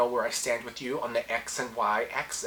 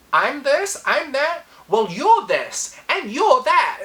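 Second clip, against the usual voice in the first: higher, louder mock tit-for-tat outburst; hammed-up heated snapping back; old self lashing out; 10 semitones higher, 7 dB louder, slower than usual. Her old self firing back at insults, mock heated outburst. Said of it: "the old me would have been like".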